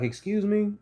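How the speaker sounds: high, stunned disbelief; taken aback, pitch shooting up; offended double take; shocked excuse me. Retold or imitated. Reacting to an offensive remark.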